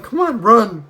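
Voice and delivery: loud, high retold urging shout. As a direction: hurry her along, loud and high. Violinist Ben urging her.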